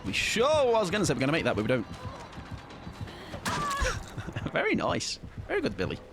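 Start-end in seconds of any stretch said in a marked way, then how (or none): 1.9-4.5 high breathy giggle. Real, not acted. Laughing at a missed play.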